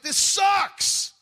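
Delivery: high exasperated shout; crying out. Acted. Preacher voices David complaining to God, exasperated outcry. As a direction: blurt it out as a loud complaint to God. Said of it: "He's crying out to the Lord".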